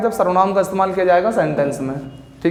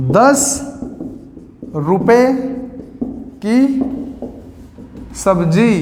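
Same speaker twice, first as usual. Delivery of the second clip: each word spoken alone with long pauses; slow dictation; much slower than usual. Shown as a demonstration. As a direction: dictate it word by word for students to write. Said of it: "teacher dictates word by word". (शब्द-शब्द रुककर धीमी बोलकर लिखवाना)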